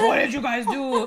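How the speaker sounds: mock the principal through a laugh; mocking Weatherby. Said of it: "He's like".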